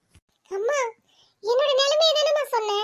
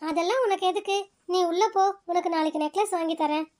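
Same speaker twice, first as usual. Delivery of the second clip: lower, gentle brother soothing his sister; a gentle lowered male voice, reassuring; calm older brother; 6 semitones lower than usual. Acted. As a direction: settle her down like a patient elder brother. Same male figure.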